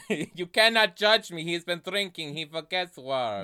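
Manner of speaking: put-on high, hammy French-accented protest. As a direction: defend him in a French accent. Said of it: "focused on this accent".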